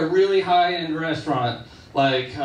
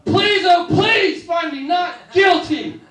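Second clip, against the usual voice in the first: much higher, louder, slow begging shout; desperate plea; 12 semitones higher, 6 dB louder, slower than usual. Retold or imitated. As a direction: beg the jury at the top of your voice. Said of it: "the Doctor Seuss defense".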